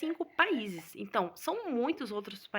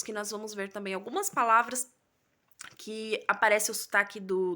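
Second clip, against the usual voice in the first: normal talking voice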